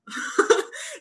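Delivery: sudden laugh that jumps way up; a real laugh at her own story; high burst of laughter. Real, not acted. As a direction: let a laugh pop out high.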